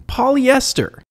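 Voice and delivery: exasperated disbelief at a silly name; incredulous repeat. Real, not acted.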